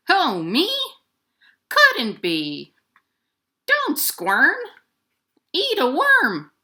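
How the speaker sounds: a loud huffy raven; bossy raven. Acted. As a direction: brush off the blame, squawk it. Raven denies, scolds.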